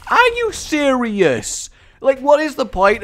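high, loud, slow disbelief; exasperated, drawn-out cry; indignant outburst. Real, not acted. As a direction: protest loudly at the game. Outraged at getting hit.

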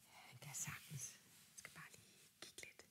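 put-on hushed whisper to the child. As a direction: lean in and whisper it. Host relays puppet's whisper.

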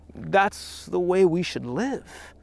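ordinary talk

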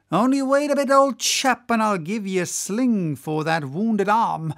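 cruel whaler calling out, high and loud at first; cruel cry. Acted. Whaler to the old whale with a wounded arm, cruel cry. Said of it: "cried cruel Flask".

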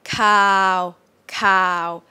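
"cow" held flat and monotone; mid tone. Shown as a demonstration. Teacher models the Thai midtone, flat "cow". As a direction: say "cow" level and long, no up and down.

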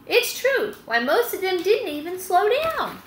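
high, put-on hen voice; a fussy hen's indignation; indignant hen. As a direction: squawk the complaint. Hen complaining after failed day.

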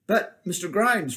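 high whiny protest; complaining. A narrator's reading. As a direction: plead like a sore boy. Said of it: "Francis complained".

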